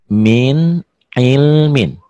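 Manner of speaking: min ilmin drawn out very slowly, each sound clear; slow recitation. Shown as a demonstration. Teacher reciting min ilmin slowly for students to copy. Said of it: "Lihat ya".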